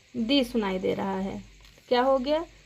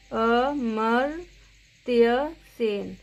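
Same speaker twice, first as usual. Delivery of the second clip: slow teaching read; much slower than usual. Shown as a demonstration. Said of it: "teacher shows a word slowly".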